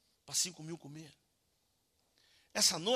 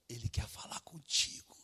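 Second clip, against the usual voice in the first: slow, whispered appeal; intense whisper; 3 semitones lower, slower than usual. Real, not acted. Preacher telling congregation God wants them, slow whisper. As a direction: draw it out in an earnest whisper.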